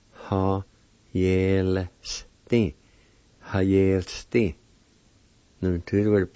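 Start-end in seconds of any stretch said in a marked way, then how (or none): 0.1-2.8 "Ha, yel, sti" in separated syllables. Shown as a demonstration. Teacher repeating the word for penknife, syllable by syllable. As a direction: sound out "Ha, yel, sti" for learners. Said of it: "It's pronounced like this".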